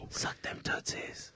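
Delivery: slow creepy whisper; mock whisper. Co-host echoing the toe joke, slow creepy whisper. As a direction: whisper it slowly, leering.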